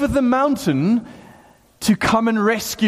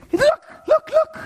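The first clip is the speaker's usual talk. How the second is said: very high, excited repeated cry; grabbing attention urgently. Acted. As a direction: grab someone and insist in a squeaky high pitch. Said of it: "John's getting hold of me and saying".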